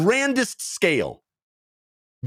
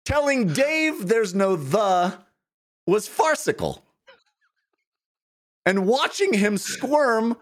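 recited verse, higher and playful; limerick; 5 semitones higher than usual. Pretend. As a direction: perform a triumphant limerick. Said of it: "Here's one more".

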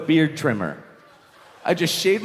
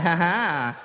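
mock laugh. Mock laugh at tricking his opponent.

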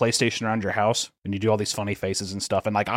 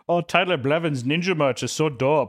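higher-pitched mock German accent; put-on German accent; 4 semitones higher than usual. Berliner gushing over ninja merch, in a hammed-up German accent. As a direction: play an esports fan from Berlin with a German accent. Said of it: "done about four different cultural accents".

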